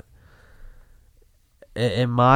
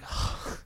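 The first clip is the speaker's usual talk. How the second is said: voiceless, soft sigh; exasperated sigh; 8 dB quieter than usual. Retold or imitated. DJ reacting to softball questions, exasperated sigh. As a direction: let out a weary breath.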